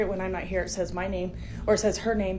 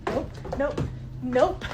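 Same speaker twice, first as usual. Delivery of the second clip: alarmed refusal; 3 semitones higher, much slower than usual. Real, not acted. Woman fleeing the upstairs noise, alarmed refusal. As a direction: repeat it in mounting alarm, each one jumping up. Backs away from haunted room.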